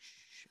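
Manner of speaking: soft hushing shh; quiet a child with a hiss of air; shushing. Retold or imitated. Parents hushing their noisy kids, a soft shh. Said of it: "parents are like".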